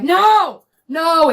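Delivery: high, loud wail of dismay; panicked cry. Retold or imitated. Driver seeing police right on her tail, panicked cry. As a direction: cry out as if caught, re-enacted.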